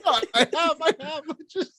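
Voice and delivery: high laughing protest. Real, not acted. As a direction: giggle through the words. Cracking up over niblets.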